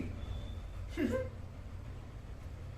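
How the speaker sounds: breathless high creepy laugh; a quiet unhinged titter; high eerie giggle. Acted. (ऊँची डरावनी हँसी)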